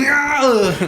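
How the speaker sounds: high shouted Austrian yell; Schwarzenegger impression. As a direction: yell it like Arnold. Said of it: "That was actually Arnold Schwarzenegger".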